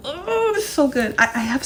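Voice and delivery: high, breathless rush of awe, voice leaping up; too thrilled to get the words out; high gasping delight, words tumbling; overwhelmed gasp. Real, not acted. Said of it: "I can't even get anything out".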